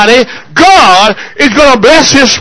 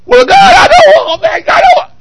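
whiny refusal. Retold or imitated. Mimicking a believer refusing God.

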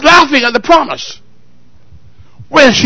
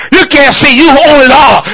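normal talking voice

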